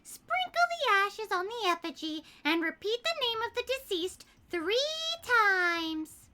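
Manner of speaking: high, hammy reading voice; reading the book aloud. Acted. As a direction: ham up the instructions. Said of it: "burn this Necronomicon".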